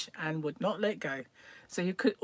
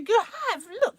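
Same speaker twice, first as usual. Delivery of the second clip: put-on loud, high-pitched child insisting; booming child's shout; 7 dB louder, faster than usual. Son contradicting his embarrassed mother, booming loudly. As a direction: boom it out like a stubborn little boy. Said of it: "he had a big booming voice".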